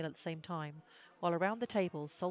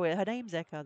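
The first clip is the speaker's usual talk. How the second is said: usual speaking voice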